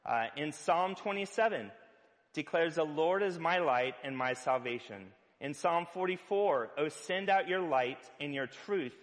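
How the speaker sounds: ordinary talk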